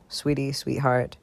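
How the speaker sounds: usual speaking voice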